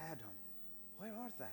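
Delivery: tender call from a loving God. Shown as a demonstration. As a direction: call gently, longing for him. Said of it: "I believe he called it out of love".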